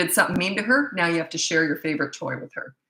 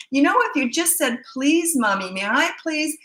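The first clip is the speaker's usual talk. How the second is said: a higher, sing-song imitation of a pleading mother; mock pleading mom; 8 semitones higher than usual. Mocking the other mom's pleading.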